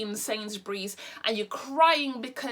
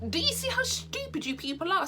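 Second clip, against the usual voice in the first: exasperated; 8 semitones higher than usual. Real, not acted. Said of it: "ranting at viewers".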